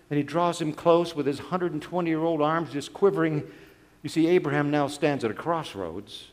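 usual speaking voice

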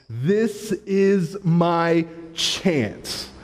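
very slow, drawn-out inner thought; savoring the chance. Retold or imitated. Pastor realizes this is his chance to invite the server, very slowly savored. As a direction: stretch out every word with relish. Seizes the golden opportunity in thought.

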